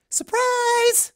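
very high, loud squeal of surprise; Pinkie's surprise shout. Acted. Pinkie popping out of the kitchen at Twilight, loud high shriek. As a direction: burst out and shriek it. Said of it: "Pinkie popped out of the kitchen".

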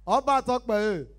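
loud, high catchphrase; loud crowd call. Acted. Comedian hailing the crowd, loud call. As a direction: call it out to the hall.

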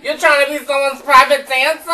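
mock through helpless laughter; cracking up, barely getting words out; laughing mockery. Cracking up at the singer.

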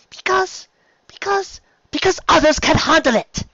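high stammered start rising to loud outburst; aghast exclamation. A narrator's reading. Rarity aghast at Spike fighting, exclaiming. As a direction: grope for words, then burst out shrilly. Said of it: "Rarity exclaimed, looking completely aghast".

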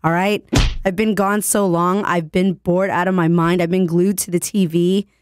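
hoarse, gravelly sick voice; raspy voice. Real, not acted. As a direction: rasp through it, voice worn from illness. Sick radio host, raspy throughout.

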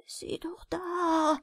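slow, high breathy whisper of fear; fearful whisper. A narrator's reading. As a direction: whisper it, pointing in dread. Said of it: "flüsterte dieser angstvoll". (ängstliches Flüstern)

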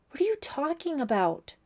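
high-pitched, exasperated question. A narrator's reading. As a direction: demand an answer, losing patience. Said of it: "beginning to be exasperated".

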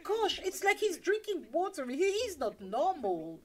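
high-pitched mock astonishment; astonished onlookers. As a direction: act out baffled, scandalised observers. Religious leaders voiced, astonished.

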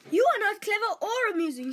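put-on lightly sneering older brother. Brothers mocking Dan on the road, lightly sneering.

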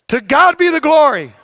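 high, loud call to the congregation; emphatic declaration. Shown as a demonstration. Pastor leading the congregation in the call, high and loud. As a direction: belt "To God be the glory" out as a call. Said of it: "Can we try it?".

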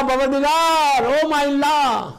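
high, loud, slow cry to heaven; calling out to God. Acted. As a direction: call up to God. Moses cries out on Tur.

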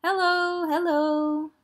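high, sing-out greeting; calling out. A narrator's reading. Friends outside Tigger's new door, calling out. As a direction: call a drawn-out greeting from outside. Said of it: "all his friends calling outside".

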